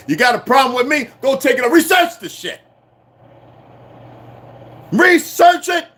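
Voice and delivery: high, heated shout at the viewers; challenging shout. Real, not acted. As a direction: throw down a challenge, rising to a yell. Ranting challenge to doubting viewers.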